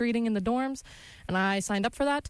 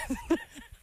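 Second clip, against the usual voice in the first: high squeaky laugh; 13 semitones higher, 4 dB quieter than usual. Real, not acted. Laughing at own mistake.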